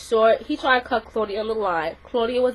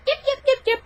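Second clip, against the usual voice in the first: high, yappy little dog barks; chihuahua yapping. Retold or imitated. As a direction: yap like a tiny chihuahua. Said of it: "like a little chihuahua".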